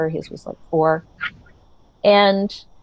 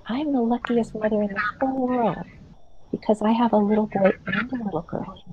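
approving mother; 3 semitones higher, 5 dB quieter than usual. Retold or imitated. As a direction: coo at him like an accepting mother. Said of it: "The approving mother".

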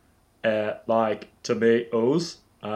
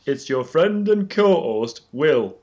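slow, louder host intro; mock grand intro; 2 semitones higher, 4 dB louder, slower than usual. Will pretending to be the missing co-host, slow and grand. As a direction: announce yourself like a showman. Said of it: "I'm here".

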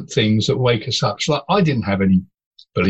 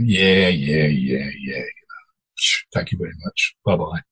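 dismissive brush-off; 6 semitones lower, 3 dB quieter than usual. Retold or imitated. Brushing off caller, retold.

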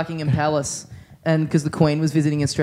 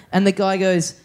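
usual speaking voice

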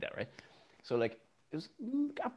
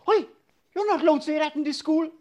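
much higher, louder, indignant little pupil; mimicking a schoolgirl; 11 dB louder than usual. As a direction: play the kid scolding her teacher. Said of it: "she just put her hand up and goes".

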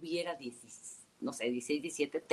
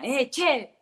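imitating an Argentine dad yelling, higher and louder; dad's Argentine shout; 9 semitones higher, 6 dB louder than usual. Dad yells from the stands to his bleeding daughter, mock Argentine shout. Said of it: "y me grita mi papá".

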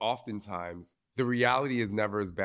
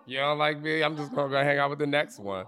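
higher, louder brash dismissal; Jersey attitude; 6 semitones higher, 5 dB louder than usual. Acted. Jersey native to someone who dislikes them, brash dismissal. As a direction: act out brushing them off, Jersey style. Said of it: "when you're from where we're from".

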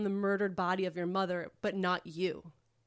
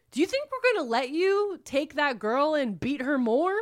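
higher-pitched retold outrage; indignant challenge; 5 semitones higher, 4 dB louder than usual. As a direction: challenge him, acting out the stranger's outrage. Strangers confront captor in retold scene.

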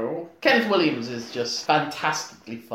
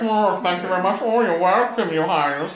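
higher, lightly put-on Williams voice; Kenneth Williams impression; 3 semitones higher than usual. Kenneth Williams being knighted, thanking royalty in mock voice. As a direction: fluster through the thanks like a camp star. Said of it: "When he was getting knighted, it's like".

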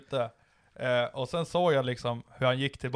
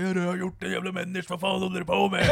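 higher-pitched retold quarrel; put-on outrage, pitched up; mock angry yelling; 6 semitones higher than usual. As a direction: imitate an indignant shouter without full volume. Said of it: "skriker åt varandra".

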